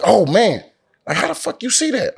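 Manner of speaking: high-pitched mock astonishment; retold amazement. Guide amazed he spotted the bushes, retold amazement. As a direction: voice jumps up in disbelief, imitating the guide.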